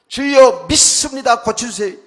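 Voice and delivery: loud, high, drawn-out plea to the Lord; mock-fervent shouted prayer; fervent prayer cry. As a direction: cry it out like a zealous believer praying for healing. Acted prayer of blind faith.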